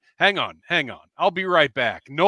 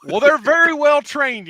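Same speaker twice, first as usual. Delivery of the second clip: cowboy voice; 8 semitones higher, 6 dB louder than usual. Acted. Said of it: "I can't keep that up".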